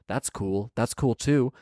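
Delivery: ordinary delivery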